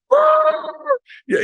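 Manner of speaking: very high mock-furious scream; exploding in pretend anger; angry shriek. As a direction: let out a strained, high yell of rage. Acts out being really angry.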